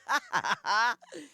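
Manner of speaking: high burst of laughter; real loud cackle. Real, not acted. Laughing at the memory.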